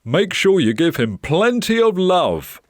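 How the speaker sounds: high, loud encouraging instruction; animated, projected urging; emphatic owner. Acted. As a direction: press the main point on the child. Owner stresses the key instruction.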